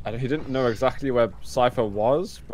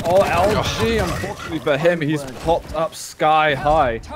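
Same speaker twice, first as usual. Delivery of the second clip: excited; 5 semitones higher, 6 dB louder than usual. Real, not acted. Hyped call of a big play.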